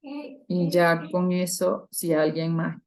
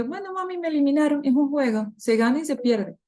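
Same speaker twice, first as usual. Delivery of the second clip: put-on higher little-girl voice, calm and accepting; daughter's voice; 8 semitones higher than usual. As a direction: play a small girl shrugging off losing a game. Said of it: "y Leticia me dice".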